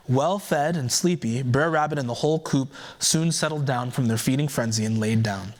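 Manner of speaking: usual speaking voice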